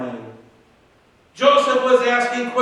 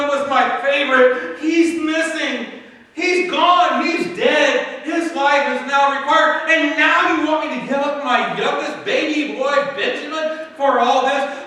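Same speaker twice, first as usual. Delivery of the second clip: put-on high, anguished old father's cry; a heartbroken father, pitched high; grieving father; 6 semitones higher than usual. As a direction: plead as a father losing his youngest son. Grieving father voiced in story.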